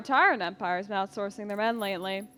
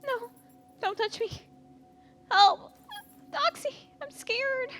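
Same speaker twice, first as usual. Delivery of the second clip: much higher panicked cries for help; frightened screams; slower than usual. Acted. Young girl grabbed by a slaver, frightened screaming.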